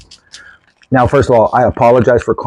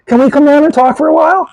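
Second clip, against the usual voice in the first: higher, fast, eager asking voice; imitating eager Rick; 13 semitones higher, faster than usual. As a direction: friend begging to come down for drinks. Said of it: "He's like".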